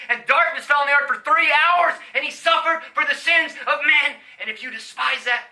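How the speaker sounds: loud, high, rising sermon cadence; full-force preaching. Acted. Preacher telling the crowd of Christ's suffering, at full force. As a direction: lift it toward the cross, full voice.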